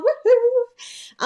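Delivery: high, loud woohoo; excited cheer. Real, not acted. Cheering that the art is at the post office, excited.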